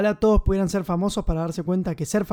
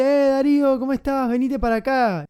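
loud, high call across the street; 6 semitones higher, 3 dB louder than usual. Retold or imitated. Fan shouting to Darío from across the street, loud and high. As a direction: hail him from the far sidewalk.

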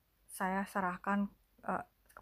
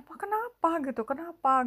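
high, louder retold question; concerned questioning; 8 semitones higher, 4 dB louder than usual. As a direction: act out urgently asking father what's wrong. Family questioning crying father. (tiruan bertanya cemas, nada tinggi)

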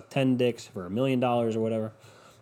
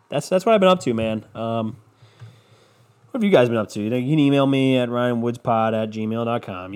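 ordinary talk, nothing marked

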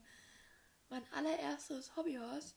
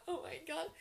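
laughing; 8 semitones higher, 7 dB louder than usual. Real, not acted. Laughing at embarrassing printed photos.

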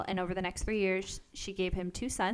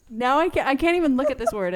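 laughing; 6 semitones higher, 9 dB louder than usual. Real, not acted. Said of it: "making me laugh".

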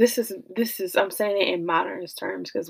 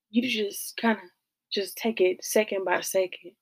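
ordinary delivery

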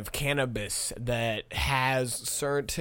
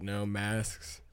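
ordinary talk, nothing marked

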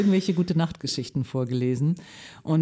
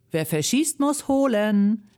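call out; 4 semitones higher, slower than usual. Acted. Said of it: "rief Mehmet".

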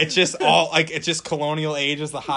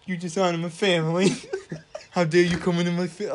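mock offended patriarch; 3 semitones higher than usual. Mock offended father figure.

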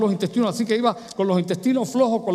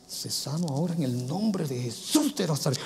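healing prayer; 3 semitones lower, 6 dB quieter than usual. Retold or imitated. Imitating praying over the sick.

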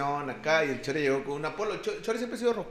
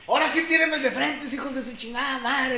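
yelling challenge; 8 semitones higher than usual. Retold or imitated. Chore challenging the whole cantina, high yelling dare. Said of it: "le grita a toda la cantina".

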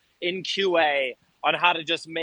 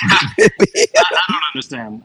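laughing through the words; can't stop laughing while talking; 2 semitones lower, 10 dB louder than usual. Real, not acted. Laughing at mic joke.

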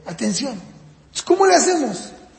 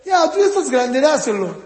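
higher mock parent; impatient parent; 4 semitones higher than usual. Typical parent ordering the older child to hand it over, mock impatient. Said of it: "La mayoría de los papás, ¿qué hacen?".

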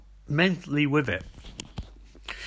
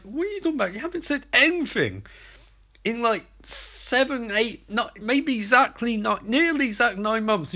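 incredulous; 7 semitones higher than usual. Retold or imitated. Sisko mocking Joe's sudden objection.

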